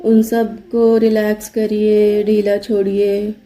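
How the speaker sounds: even, unhurried meditation voice with long pauses; guided meditation. Acted. Yoga teacher leading listeners to relax body and brain, guided meditation. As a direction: guide them steadily into relaxation, leaving space after each instruction.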